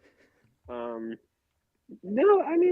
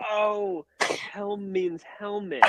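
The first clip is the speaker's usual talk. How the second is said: higher, slow mock epiphany; belated realization; 5 semitones higher, 4 dB quieter, much slower than usual. Imitating her halfway through the show, belated realization. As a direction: work it out slowly, pitched up in wonder. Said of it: "way late to the realization".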